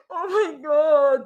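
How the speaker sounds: loud, high, very drawn-out fake wail; mock distress. Host mocks woman acting like a victim, loud mock distress. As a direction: wail it slowly, hammed-up and tearful. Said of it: "Parang akala mo, ginagahasa".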